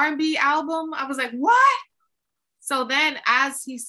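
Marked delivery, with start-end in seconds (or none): 0.0-0.9 high, loud shouted news; excited shout. Retold or imitated. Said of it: "So he's screaming". 1.3-1.8 very high, loud shriek of surprise; hearing the category, shocked shriek; shocked what. Retold or imitated. Shocked at the news.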